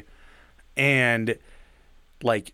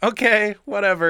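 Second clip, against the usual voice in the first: dismissive shrug; 9 semitones higher, 5 dB louder than usual. Retold or imitated. Shrugging off a weird sight.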